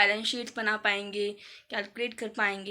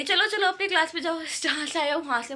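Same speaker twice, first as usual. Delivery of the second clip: put-on raised, higher scolding voice shooing kids off; scolding teacher; 5 semitones higher, 3 dB louder than usual. Art teacher shooing students away, loud scolding. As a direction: mimic a teacher yelling at you to get back to class. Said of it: "भाई इतने तेज तेज बोल रहे हो".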